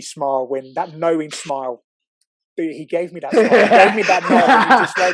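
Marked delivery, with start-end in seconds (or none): 2.6-5.1 loud laughing retelling; words broken up by loud laughter. Real, not acted. Laughing loudly to a friend about Billy's knowing smile.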